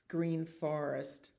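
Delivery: usual speaking voice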